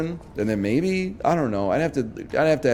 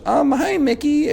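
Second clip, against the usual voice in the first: much higher, sweet cartoon squeak. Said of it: "three different voices that hurt my throat".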